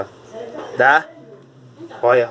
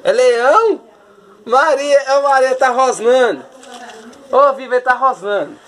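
excited; 6 semitones higher, 3 dB louder than usual. Real, not acted. Excited about panda growling.

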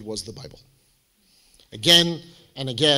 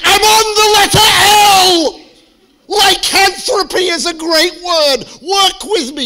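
very high, shouted nerdy zeal; hammed-up manic roommate; 12 dB louder than usual. Roommate showing off her dictionary reading, hammed-up manic shout. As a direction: shriek it slowly with overblown excitement. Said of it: "she's like".

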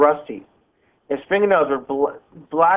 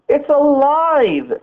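high, drawn-out narrated scream; 10 semitones higher, 4 dB louder, much slower than usual. A narrator's reading. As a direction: cry out in alarm, pitch soaring, words stretched. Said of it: "she screamed".

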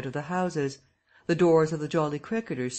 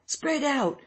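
even higher repeated call; crying out; 11 semitones higher than usual. Acted. Someone in the crowd repeats the order, pitched even higher. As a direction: push the order up higher a second time. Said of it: "cried someone".

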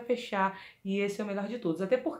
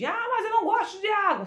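queixa de aluna; 10 semitones higher than usual. Pretend. Aluna imaginária reclama.

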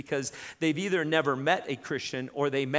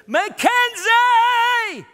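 very high, loud, long drawn-out call; desperate shout; 12 dB louder, much slower than usual. Retold or imitated. Mother calling her lost child, drawn out and loud. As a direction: cry the name out. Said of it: "like Heather".

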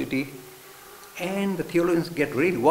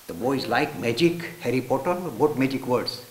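ordinary talk

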